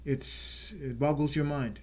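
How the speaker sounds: usual speaking voice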